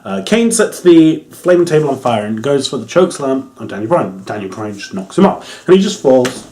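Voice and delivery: ordinary talk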